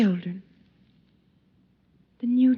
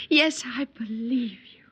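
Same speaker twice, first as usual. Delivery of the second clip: ordinary talk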